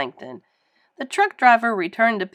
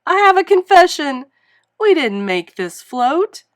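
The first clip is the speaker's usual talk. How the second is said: loud high declaration, easing; public confession; 7 semitones higher, 7 dB louder than usual. A narrator's reading. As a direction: confess to the whole crowd.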